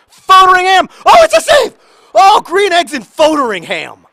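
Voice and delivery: very high, loud celebration; ecstatic shout. Real, not acted. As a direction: scream with joy at the save. Goalkeeper's penalty save.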